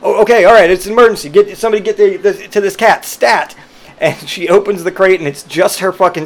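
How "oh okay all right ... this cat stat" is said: high, loud flustered orders; hammed-up hospital urgency; mock emergency panic. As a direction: staff scrambling, imitated alarm. Vet staff emergency panic.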